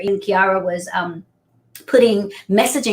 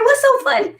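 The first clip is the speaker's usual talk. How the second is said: much higher, innocent question; puzzled son. Retold or imitated. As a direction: squeak it out like a confused kid. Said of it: "he was like".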